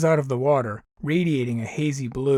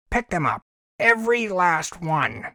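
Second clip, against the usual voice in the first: furious order; 5 semitones higher, 4 dB louder than usual. Acted. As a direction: bark the order.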